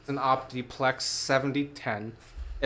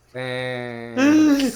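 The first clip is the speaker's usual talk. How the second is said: a lightly sarcastic thanks, a touch higher and louder; deadpan thanks; 8 semitones higher, 5 dB louder than usual. Retold or imitated. Handed Nesquik water, giving the seller a mock-polite thanks. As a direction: pretend to be grateful for a bad drink. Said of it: "and I'm like".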